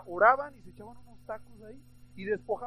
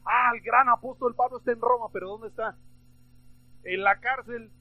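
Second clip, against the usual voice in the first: loud mock awe at the great man; mock exclamation; 9 dB louder than usual. Rivals talking of the great apostle, loud mock exclamation. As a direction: exclaim it loudly, as rivals would.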